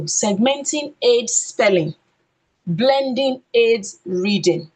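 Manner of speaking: slow and loud, each word weighted; slow emphatic. Shown as a demonstration. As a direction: spell out the key rule slowly for the class. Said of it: "Do you get the difference?".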